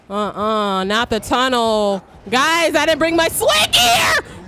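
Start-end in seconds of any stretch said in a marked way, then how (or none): 2.3-4.3 high, loud, slow wailing complaint; panicked wail. Real, not acted.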